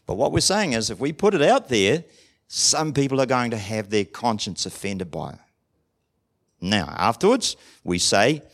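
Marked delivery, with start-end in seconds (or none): none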